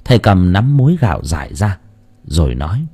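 usual speaking voice

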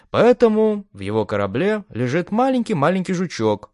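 a hint of a smug grin; smirking. A narrator's reading. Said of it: "усмехнулся Дэн".

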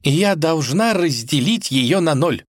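high, slow insistent princess; determined princess putting her foot down; firm, resolute. A narrator's reading. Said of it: "твёрдо сказала, топнув ножкой".

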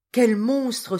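loud, high indignant exclamation; horrified outrage. A narrator's reading. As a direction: cry out in shocked disgust. Geneviève horrifiée par le vol. (indignation horrifiée, voix haute et forte)

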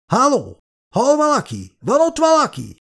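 high shouted calls through a wall; desperate high shouting; desperate calling. Acted. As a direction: call for help, trapped. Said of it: "elkeseredetten verte".

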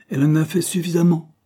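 angry. A narrator's reading. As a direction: answer back in anger, raising the pitch. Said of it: "expliqua Cross d'un ton de colère".

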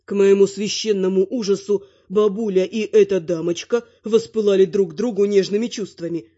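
plain reading voice

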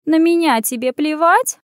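high, hurt cat voice; cat's voice. A narrator's reading. Cat reproaches her runaway friend, high-pitched. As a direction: accuse her as a neglected cat. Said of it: "голос кошки".

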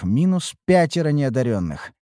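usual speaking voice

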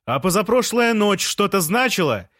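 high-pitched, provoking retort; shouted challenge. A narrator's reading. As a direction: shout the question up from the street. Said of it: "закричал с улицы Жан".

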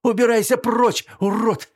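high, loud furious scream. Acted. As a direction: scream him out of the room. She throws a box at him.

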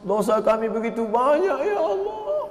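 high, drawn-out wailing plea to God; mock-weeping prayer, climbing very high at the end; imitated tearful prayer. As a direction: plead as if weeping, stretching the words. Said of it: "saya nggak bisa nangis".

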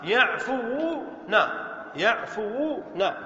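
يعفوون slowly, waw held long; slow demo. Shown as a demonstration. Teacher to class, slow careful يعفوون. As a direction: spell يعفوون out by sound. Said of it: "فنقول ماذا".

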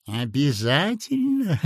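put-on sweet-talking sinister old man; sly old man. A narrator's reading. Old clerk character voice. (вкрадчивый зловещий старичок)

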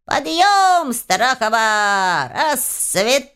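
high, drawn-out bellow from a house spirit; yelling wake-up call. A narrator's reading. House spirit waking the heroine at dawn, full-throated yell. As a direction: holler it like a reveille, stretching each word. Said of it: "во всю силу своей глотки завопившего".

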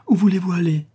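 a man shaken by passion, voice rising; Trémicourt, quivering as he stops her leaving; trembling with emotion. A narrator's reading. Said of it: "lui dit-il en frémissant".

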